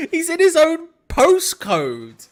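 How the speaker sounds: cracking up at the defender; laughing. Real, not acted. Laughing at unmarked Veltman.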